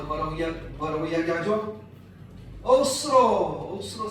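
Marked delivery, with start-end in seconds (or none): 2.6-4.1 Gyeongsang 억수로 drawn out very slow and loud; Gyeongsang dialect word. Shown as a demonstration. Preacher gives the Gyeongsang dialect 억수로 with a long stretch. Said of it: "경상도 말로".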